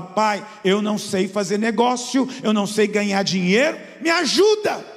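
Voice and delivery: high, pleading plea to God, rising at the end; pleading prayer. Retold or imitated. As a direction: beg the Father for help, voice climbing. Pastor retells praying to God.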